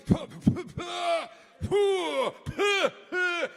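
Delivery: high, loud Gallic puffs; loud French-style puh noises. Retold or imitated. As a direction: puff out loud mock French sounds. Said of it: "just made noises".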